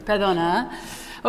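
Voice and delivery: ordinary delivery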